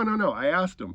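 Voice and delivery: ordinary delivery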